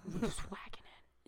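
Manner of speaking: hushed whisper. Real, not acted. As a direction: breathe it out. Whispered joke.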